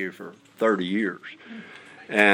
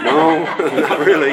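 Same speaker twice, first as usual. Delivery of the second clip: chuckling, louder and higher; laughing denial; 6 semitones higher, 8 dB louder than usual. Real, not acted. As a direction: laugh off the compliment. Laughing at king chaplain remark.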